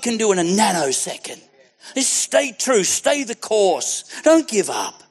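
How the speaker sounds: high, forceful pulpit exhortation; impassioned preacher; preaching. Acted.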